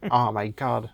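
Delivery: mock-American "Oh my God"; American accent. Mimicking dubbed film dialogue for friends, American accent. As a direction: drop an exaggerated American exclamation into French. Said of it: "ils le disent avec l'accent".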